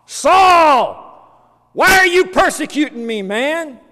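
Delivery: high, very loud calling out; full-lunged shout. Acted. Jesus confronting Saul on the road, shouting at full force. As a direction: bellow the name, then demand an answer. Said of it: "he shouted at the top of his lungs".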